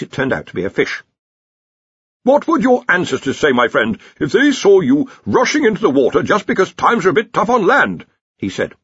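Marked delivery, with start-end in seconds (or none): 2.2-8.0 high, loud reproach; indignant scolding wizard. Acted. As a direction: lecture the creature like a disgraced relative. Dean scolds the creature.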